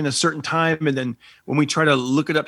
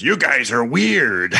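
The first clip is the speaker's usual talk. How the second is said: sudden low, slow drop; imitating a deep manly voice; 2 semitones lower, much slower than usual. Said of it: "he changed his voice like that".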